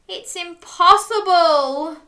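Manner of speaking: slow, loud, high lament; sad. A narrator's reading. Dog refusing the road trip, sad and drawn out. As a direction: wail it mildly, drawing out the words. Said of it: "he said sadly".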